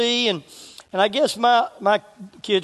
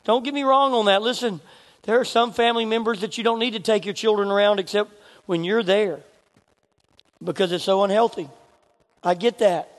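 ordinary talk

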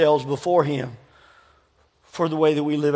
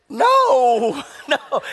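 a higher "no" that dissolves into a laugh; laughing refusal; 7 semitones higher than usual. Real, not acted. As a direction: refuse through real laughter.

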